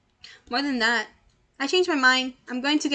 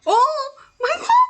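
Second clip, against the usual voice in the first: much higher, much louder, slow shocked cry; horrified scream; 14 semitones higher, 10 dB louder, slower than usual. Real, not acted. Streamer sees a shocking scene, horrified scream. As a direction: scream in real horror.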